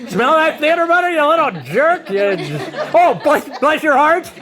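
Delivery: gloating. Retold or imitated. Dad gloating with popcorn at his son upstairs, laughing loudly. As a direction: crow at him through your laughter.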